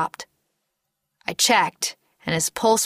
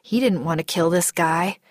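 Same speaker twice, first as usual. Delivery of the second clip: ordinary delivery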